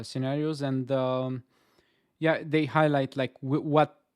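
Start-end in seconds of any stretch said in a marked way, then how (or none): none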